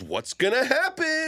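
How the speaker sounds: high, mock-dramatic question; mock suspense. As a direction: fake suspense, tongue in cheek.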